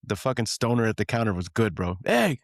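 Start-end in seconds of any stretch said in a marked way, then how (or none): 2.0-2.4 high sharp shout of "Hey!"; retold yell. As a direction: yell like a clerk catching a kid. Stoner yelling at sneaking kid.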